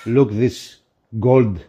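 ordinary talk

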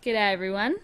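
loud Australian presenter voice; Australian accent. Pretend. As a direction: read the intro in an Aussie accent. Retaking the Aussie intro.

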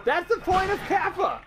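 high, shouted retort; exasperated shout. Real, not acted. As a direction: shout it back in protest, pitch way up. Heated argument over a joke.

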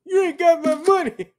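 high, loud squeaky mock rant; hammed-up outraged lawyer demanding pay; mock angry lawyer voice. Watching a meme, voicing the angry lawyer: high mock rant.

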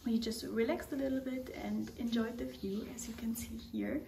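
soft whispered narration, voice barely there; hushed traveller's whisper, confiding to the camera; whispered vlog. Real, not acted. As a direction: whisper so as not to disturb anyone nearby.

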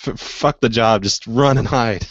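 ordinary delivery

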